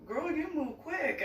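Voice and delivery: a hint of the cashier being amazed; cashier's voice. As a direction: lightly play the impressed cashier. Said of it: "the cashier looks at me and she's like".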